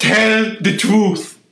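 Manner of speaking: slow, loud movie shout. Retold or imitated. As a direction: bellow it out slowly. Quoting movie lines.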